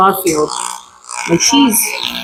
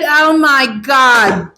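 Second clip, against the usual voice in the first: drawn-out exclamation; much slower than usual. Retold or imitated. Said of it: "And I said".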